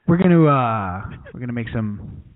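low, hesitant, bland TV cook; mild-mannered cooking show host, noncommittal; Chef Neutral. Acted. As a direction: trail along low and unexcited. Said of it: "everything Chef Neutral makes is, like, very bland".

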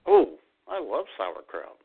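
usual speaking voice

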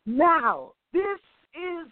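high, retold astonishment; amazed. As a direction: exclaim in hammed-up amazement, word by word.

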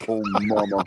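mock Southern film line; hammed-up bad Southern accent; Dan Aykroyd. Co-host quoting Driving Miss Daisy, mock Southern accent. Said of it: "Dan Aykroyd in, uh, Driving Miss Daisy said".

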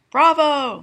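loud, high cheer; mother cheering the popped cork; cried bravo. Acted. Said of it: "Melanie cried".

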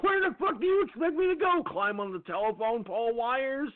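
high, mock-outraged yell at the driver; quoting his own furious comeback; exasperated retort. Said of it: "And I'm like".

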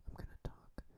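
breathy near-silent whisper. Shown as a demonstration. Whispering as a joke to his own talk show. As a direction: whisper it barely audible. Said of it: "How low can I whisper".

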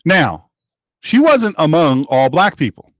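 ordinary delivery

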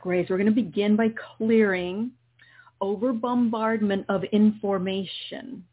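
gently guide, calm and steady; guided meditation. Acted. Continues the healing meditation.

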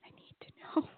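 breathy urgent whisper. Real, not acted. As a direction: whisper it to yourself. Urgent aside to co-host.

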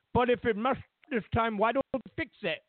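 fast mock Indian accent. Foreigner asking why America won't fix it, quick mock Indian accent. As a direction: rattle it off in a put-on Indian accent. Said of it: "I know that's my Indian voice".

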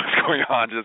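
ordinary talk, nothing marked

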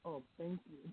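faint, distant phone voice; talking away from the phone; far from mic. Real, not acted. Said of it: "we couldn't hear her towards the end".

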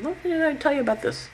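high-pitched chiding reminder; voiced Mercury. Mercury woke up the planets, high-pitched chiding. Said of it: "he woke up and he said".

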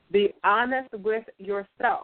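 slow, measured, word by word; deliberate, evenly spaced delivery; slow and calm. Shown as a demonstration. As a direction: say it slowly and calmly, letting each word land. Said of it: "I'm gonna say this slow and calmly".

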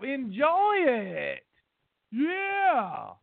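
hammed-up cheering from the sidelines; cheerleader. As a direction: egg them on like an over-the-top cheerleader. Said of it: "I'll be your biggest cheerleader".

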